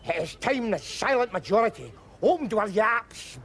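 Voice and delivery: normal talking voice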